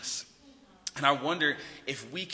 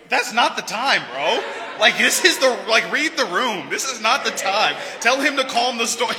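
high, loud, comic protest; mock exasperation; 8 semitones higher, 11 dB louder than usual. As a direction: yell at a clueless friend, half laughing. Acting out exasperated disciples, for laughs.

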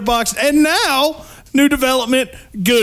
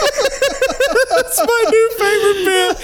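talking through a laugh; laughing so hard it breaks; 10 semitones higher, slower than usual. Real, not acted. Cracking up at the joke.

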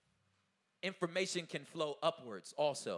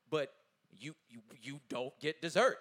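usual speaking voice

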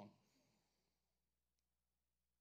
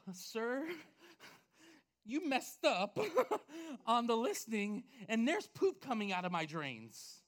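acted panic, breaking into gasping laughs; freaking out; 4 semitones higher, 5 dB louder, slower than usual. Homeowner calling the listing agent about the drains, mock freak-out. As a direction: play up a frantic, raised-voice complaint. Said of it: "I'm freaking out".

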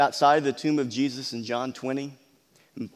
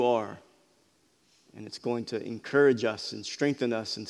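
earnest, softer pulpit prayer; 3 semitones lower, 5 dB quieter than usual. Acted. Pastor opens the sermon praying for his church, softly earnest. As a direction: pray it gently over the congregation.

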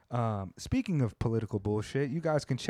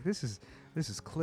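softer, higher mock easy-listening voice; Tokyo Cliff; 5 semitones higher, 6 dB quieter than usual. Host correcting the impression of a friend's intro, soft easy-listening voice. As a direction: imitate a smooth podcast host, softly. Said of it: "easy listening voice".

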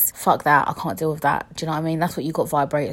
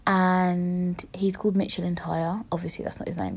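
whisper; 7 dB quieter than usual. Real, not acted. Repeating the code name.

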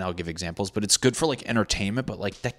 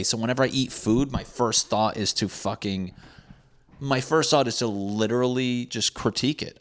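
normal talking voice

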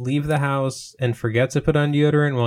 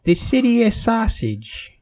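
put-on high, slow Chicago accent; exaggerated high-pitched Chicagoan; Midwest accent; 10 semitones higher than usual. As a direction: drag it out in a hammy Chicago accent. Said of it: "do a Midwest accent".

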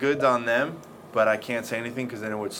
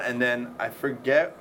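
ordinary talk

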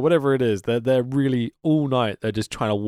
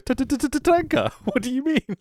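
imitating Heenan again; 13 semitones higher than usual. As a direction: stretch out the stammer for the joke. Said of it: "He goes".

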